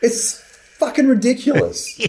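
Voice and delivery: high-pitched exasperated outburst; upset. Real, not acted. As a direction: protest in high-pitched, comic disbelief. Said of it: "it sounds like you're upset".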